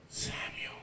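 soft, breathy whisper of a name; whispered divine call. Acted. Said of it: "and you hear".

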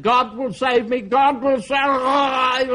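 high, drawn-out wail of faith; frantic high-pitched shout, stretched at the end; desperate drowning cry. Acted. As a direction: cry it out while going under. Believer goes under as flood rises.

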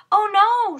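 loud, high alarmed cry; yell. A narrator's reading. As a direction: shout it out in sudden alarm. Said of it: "she yelled".

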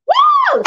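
high, loud joyful woo; excited whoop. Real, not acted. Cook whooping at her pot. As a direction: whoop with delight at the stove.

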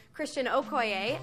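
ordinary delivery

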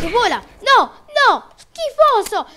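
loud, high-pitched gamer yell; outraged yelling. Real, not acted. Gamer to an escaping opponent, outraged yelling. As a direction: yell it in disgusted protest.

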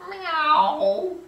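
high, loud meow; cat meow. Acted. Cat greeting.

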